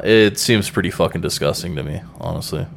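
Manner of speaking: ordinary talk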